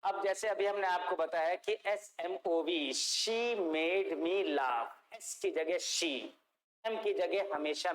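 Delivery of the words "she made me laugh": "she made me laugh" very slow, each word drawn out; slow, deliberate "she made me laugh". Shown as a demonstration. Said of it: "जोड़ेंगे तो".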